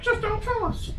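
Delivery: very high mock voice for a dismissive official; high, sarcastic impression of officials. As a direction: squeak it out like a brushing-off bureaucrat. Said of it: "we're like".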